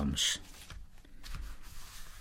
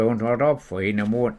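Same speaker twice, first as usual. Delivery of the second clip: usual speaking voice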